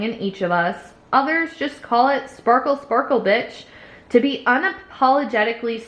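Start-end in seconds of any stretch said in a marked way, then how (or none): none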